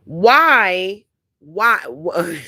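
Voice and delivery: ordinary talk, nothing marked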